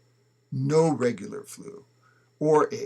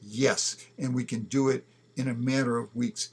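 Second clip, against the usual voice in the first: ordinary talk, nothing marked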